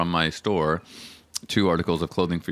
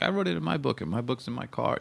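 cook's excuse voice; 8 semitones higher than usual. Retold or imitated. Cook quoted making excuses.

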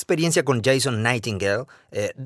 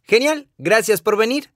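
higher, louder, breezy host saying thanks; cheery thanks; 8 semitones higher, 5 dB louder than usual. Retold or imitated. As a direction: chirp a friendly goodbye to the guests. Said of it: "y luego dicen".

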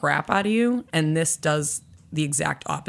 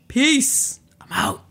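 cocky exit; 8 semitones higher, much slower than usual. Retold or imitated. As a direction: say it like a cool exit. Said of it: "I was like".

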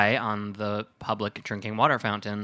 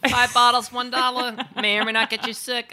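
very high, loud vendor in a bad accent; hammed-up street-seller accent, pitched way up; worst accent; 13 semitones higher, 5 dB louder than usual. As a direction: hawk it in a badly faked accent. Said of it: "That was my worst accent".

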